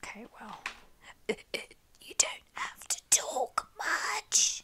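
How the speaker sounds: hammed-up hoarse whisper of a sick man; Daniel impression. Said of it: "That's my impression of Daniel right now".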